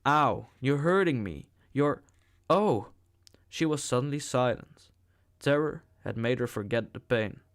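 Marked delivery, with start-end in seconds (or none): 0.0-2.9 hurt cry. A narrator's reading. Savage grips her roughly.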